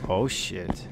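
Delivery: ordinary talk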